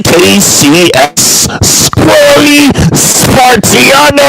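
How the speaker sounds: high, slow, drawn-out hype call; big showman name call; hammed-up announcer voice. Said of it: "I definitely went on that voice hard".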